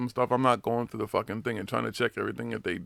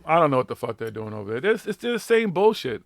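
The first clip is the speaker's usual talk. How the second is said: ordinary delivery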